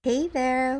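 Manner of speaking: usual speaking voice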